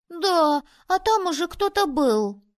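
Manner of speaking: put-on high, loud boyish interjection; excited boy. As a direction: blurt it out like an eager kid. Boys all start talking at once. (мальчишка взволнованно перебивает)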